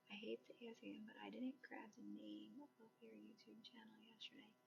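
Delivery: whispered, barely audible read; reading under breath. Real, not acted. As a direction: read the message softly to yourself. Reads a viewer's message to herself.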